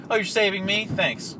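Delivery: high, loud sarcastic thanks. Retold or imitated. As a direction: fake gratitude dripping with sarcasm. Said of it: "but it's like".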